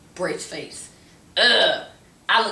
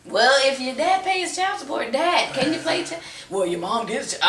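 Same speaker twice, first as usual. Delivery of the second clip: put-on childish whine; 6 semitones higher, 4 dB louder than usual. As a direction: whine like a kid asking parents.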